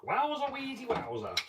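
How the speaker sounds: high, drawn-out silly wowza; playful exclamation. Pretend. Echoing the wow at the bench, playful drawn-out exclamation. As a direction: stretch it out high, then drop at the end.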